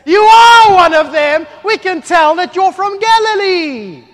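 slow, very high full-force yell; accusing shout. Acted. Crowd rounds on Peter over his accent, drawn-out accusing shout. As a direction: bellow the accusation, stretching every word.

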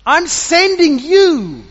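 slow divine commission building to a loud finish; voice of the Lord. Acted. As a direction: send him out with slow authority.

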